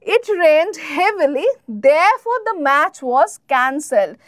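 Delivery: very slow, clear classroom read; slow, careful teacher example; slow example sentence. Shown as a demonstration. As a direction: spell it out slowly for learners. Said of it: "আচ্ছা".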